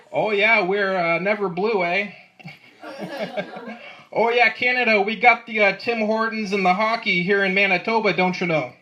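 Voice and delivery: hammed-up Canadian accent with eh; bad Canadian accent. Comic mocking Canadians, bad Canadian accent. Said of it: "That was my bad Canadian accent".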